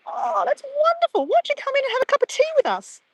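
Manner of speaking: gushing neighbour. Pretend. Imagined neighbour greeting a new Australian arrival, high and gushing. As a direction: play the delighted lady next door, a little fast.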